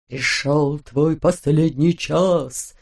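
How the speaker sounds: low, slow menacing hiss; villain's hiss. A narrator's reading. Mustached villain seizing the countess, hissing threat. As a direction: hiss it through teeth like a villain. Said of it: "злобно прошипел он сквозь зубы".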